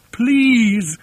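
high, drawn-out interjection; high, stretched plea; pained plea. A narrator's reading. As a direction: cut in with wounded protest. Bird pleads, offended by mention.

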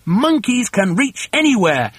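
high, emphatic retort; high-pitched bossy shout; confident shout. A narrator's reading. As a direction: call it out as a rallying boast. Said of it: "shouted Mugglewump".

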